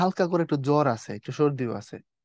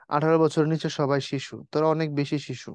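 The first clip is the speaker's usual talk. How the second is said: ordinary talk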